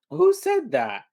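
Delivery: loud, high mock-startled question; acted-out mom. Mom talking to her son in the room, loud acted demand.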